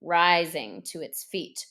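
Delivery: plain reading voice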